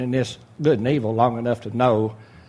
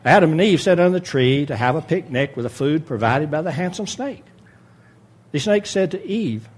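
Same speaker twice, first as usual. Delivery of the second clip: usual speaking voice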